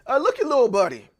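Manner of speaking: playful Tennessee ribbing; teasing buddy talk. Retold or imitated. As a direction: tease a friend fondly.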